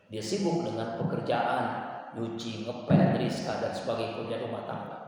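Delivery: ordinary talk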